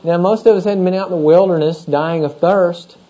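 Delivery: ordinary delivery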